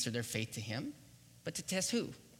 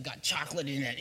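normal talking voice

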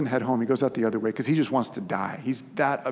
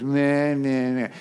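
mock toddler "meh" noises, louder than his speech; childish pouting whine; 3 semitones higher, 5 dB louder, much slower than usual. Jonah sulking in the dirt at God, mock childish whine. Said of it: "This is him throwing a childish fit".

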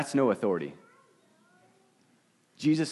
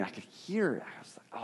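whisper; 11 dB quieter than usual. Retold or imitated. Lying in the tent, hiding from the animal: whispered. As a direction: whisper as if the animal might hear.

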